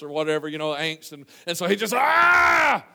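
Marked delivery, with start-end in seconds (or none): 1.9-2.8 long, high, loud cry of distress; anguished yell. Acted. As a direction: let out a drawn-out theatrical scream of anguish. King tears clothes in anguish.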